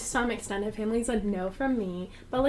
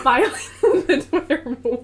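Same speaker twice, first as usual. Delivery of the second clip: laughing while reading; 4 semitones higher, 9 dB louder than usual. Real, not acted. Friend reads the account lock notice to friends, laughing. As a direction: voice rising and louder through laughter.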